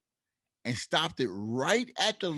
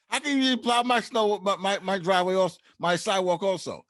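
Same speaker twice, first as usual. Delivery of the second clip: retold angry yell; 6 semitones higher, 3 dB louder than usual. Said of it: "I yelled at the guy".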